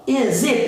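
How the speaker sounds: "is it" linked as one word, "izit"; S emendado. Shown as a demonstration. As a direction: run the S of "is" straight into "it". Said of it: "Eu emendo esse S". ("is it" com o S emendado na vogal)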